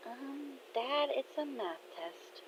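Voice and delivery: gently doubt him, hushed and high; awkward reluctance. Acted. Daughter turning down dad's math help, awkward.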